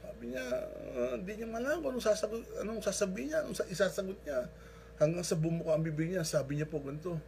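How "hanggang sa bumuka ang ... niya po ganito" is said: ordinary delivery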